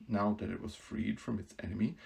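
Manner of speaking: usual speaking voice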